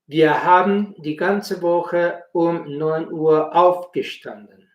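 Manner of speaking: usual speaking voice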